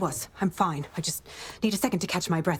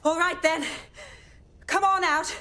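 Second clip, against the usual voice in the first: raised voice, urging someone to come out; calling out; 11 semitones higher than usual. Acted. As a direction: coax them out, calling across the room.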